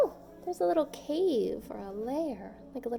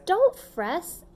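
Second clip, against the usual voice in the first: higher, louder soothing squeak; squeaky mouse; 6 semitones higher, 4 dB louder than usual. A narrator's reading. Mouse comforts the crying bear, squeaky and eager. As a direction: soothe him like a little mouse. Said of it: "Mouse squeaks".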